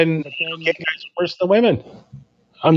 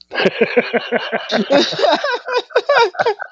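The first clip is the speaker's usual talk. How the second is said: high squealing laugh; real laugh at the joke; high laughter. Real, not acted.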